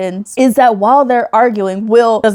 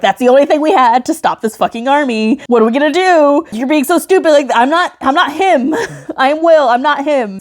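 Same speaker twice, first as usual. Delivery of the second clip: mock angry Will; 6 semitones higher than usual. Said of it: "And Will's pissed".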